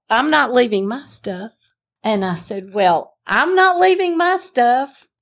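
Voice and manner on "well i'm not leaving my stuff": echoed back much higher and pitched up; mocking echo. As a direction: throw his own words back at him, mockingly high. Wife mimics husband's words back.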